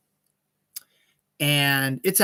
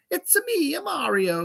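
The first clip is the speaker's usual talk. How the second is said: much higher, sing-song Italian cartoon accent; Mario voice; 13 semitones higher than usual. Vlogger quoting what fans expect Mario to sound like, high Italian cartoon accent. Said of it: "most people are used to the".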